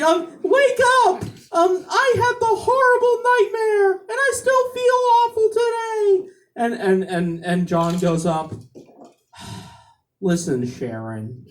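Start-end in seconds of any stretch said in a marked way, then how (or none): 0.0-6.3 much higher, whimpering wife voice; distressed wife. Acted. Wife waking husband after a nightmare, high whimpering plea. 10.2-11.4 much lower, softer husband voice; weary husband. Acted. Husband roused at three in the morning, low weary reply. Husband answering wife in joke.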